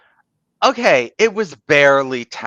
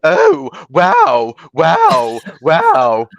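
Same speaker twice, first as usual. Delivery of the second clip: high, loud, exaggerated camp voice; 5 semitones higher, 6 dB louder, much slower than usual. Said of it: "accentuating in a homosexual accent".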